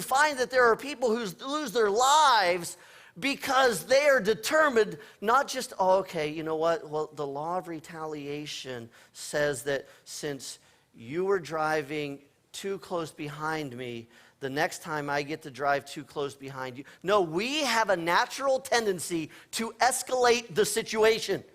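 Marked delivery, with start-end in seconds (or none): 5.8-16.8 mock reasonable driver.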